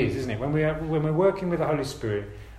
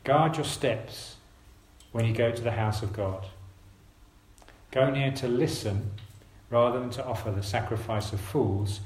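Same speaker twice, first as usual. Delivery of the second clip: slow scripture reading; 2 semitones lower than usual. Real, not acted. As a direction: read slowly and deliberately, with long pauses. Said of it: "I'm gonna read it slowly".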